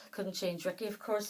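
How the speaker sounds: ordinary delivery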